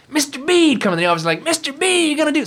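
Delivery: hammed-up commercial guy hailing Mister B; imitating ad character. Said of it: "he'd be like".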